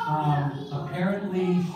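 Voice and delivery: ordinary talk, nothing marked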